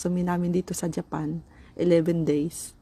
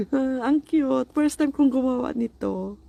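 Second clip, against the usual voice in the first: excited; 7 semitones higher, 4 dB louder than usual. Real, not acted. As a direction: gush over something cute. Delighted at her DIY pot.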